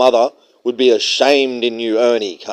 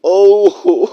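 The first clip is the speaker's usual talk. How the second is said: high, loud squeal and laugh; mock squealing laugh; 10 semitones higher, 8 dB louder than usual. Mocking the simp with a squealing laugh. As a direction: shriek with delight like a fangirl.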